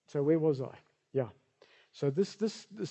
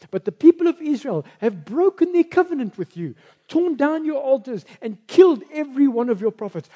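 raised, loud pleading lament; self-pitying Elijah; 7 semitones higher, 8 dB louder than usual. Acted. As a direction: plead your case like a wounded prophet. Elijah complains to God, voiced.

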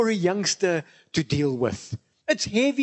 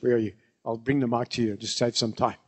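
normal talking voice

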